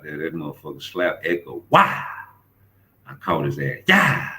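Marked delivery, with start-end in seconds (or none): 1.7-2.0 high, loud slap cry; sharp mock slap noise; shouted wah; imitated slap sound. 3.9-4.3 high, loud yell; short shouted yeah; hammed-up fight shout; mock battle yell. Slap boxing yell.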